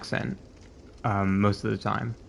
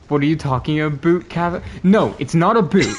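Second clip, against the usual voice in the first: higher, louder mock outrage; mock indignant; 5 semitones higher, 7 dB louder than usual. Real, not acted. Correcting a friend's accent joke, loud mock indignation. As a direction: protest loudly, half-joking.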